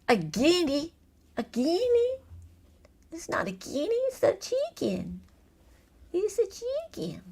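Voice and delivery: put-on high, soft southern accent. Quiz host quibbling over guinea or chicken, mock southern accent. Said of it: "Do you like my southern accent?".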